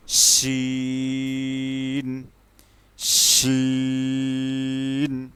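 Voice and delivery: very slow শিন with a long sh; drawn-out shin. Shown as a demonstration. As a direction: stretch the sh of শিন. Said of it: "যেমন".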